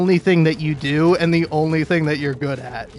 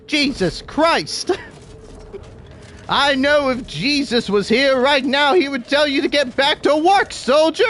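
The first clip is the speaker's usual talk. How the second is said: higher, theatrical mock outrage; exasperated pompous boss; 9 semitones higher than usual. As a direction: order the soldier back to work, hammed-up. Boss bit continues.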